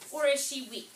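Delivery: normal talking voice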